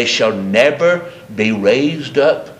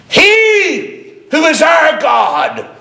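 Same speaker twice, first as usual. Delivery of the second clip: preaching shout; 4 dB louder, slower than usual. Real, not acted. Preacher's peak proclamation.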